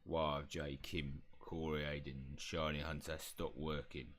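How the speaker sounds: low, slightly slowed house elf; low creature voice. Acted. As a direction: demand an answer as the house elf. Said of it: "elf voice".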